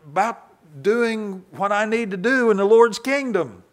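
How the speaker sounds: rising into a loud, high, fast grumble; Paul venting that the thorn holds him back; frustrated complaint. Acted. Paul, kept from preaching, complains loudly and impatiently. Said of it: "Paul thought".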